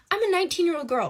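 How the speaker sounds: rushed defensive protest; defensive excuse. Real, not acted. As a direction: justify yourself quickly. Defending her own reaction.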